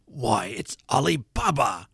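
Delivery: swooping, delighted cry of recognition; ecstatic exclamation. A narrator's reading. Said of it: "Scrooge exclaimed in ecstasy".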